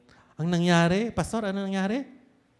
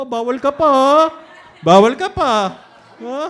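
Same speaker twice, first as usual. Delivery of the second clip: teasing loud warning; 7 semitones higher, 12 dB louder than usual. Acted. Teasing youth, not allowed yet.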